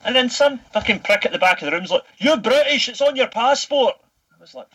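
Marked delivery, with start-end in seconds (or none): 2.2-3.9 high mock voice of a smug colleague; taunting quote pitched way up; mocking heckler.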